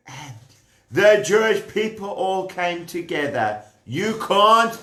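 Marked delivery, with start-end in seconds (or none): none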